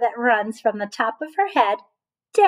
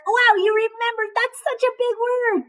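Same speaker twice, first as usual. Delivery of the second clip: much higher, delighted praise; excited praise; 12 semitones higher than usual. Real, not acted. Teacher praising a child for remembering a big word.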